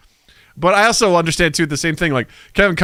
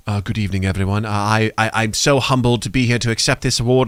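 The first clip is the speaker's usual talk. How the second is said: put-on British accent; 4 semitones lower than usual. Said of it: "these folks will come up and like".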